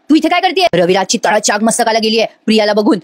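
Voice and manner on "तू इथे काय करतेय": sharp, higher angry demand; voicing the furious father, pitched high; angry outburst. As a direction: snap at the daughter in anger. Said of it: "एवढे चिडतात ना".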